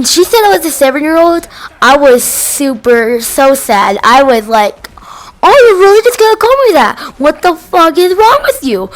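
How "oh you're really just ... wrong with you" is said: offended outburst. Retold or imitated. Kid offended at being called seven.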